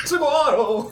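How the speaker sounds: high, ringing shout of a name; proud war cry. Acted. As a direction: fling the name out like a battle cry. Warrior cries his own name.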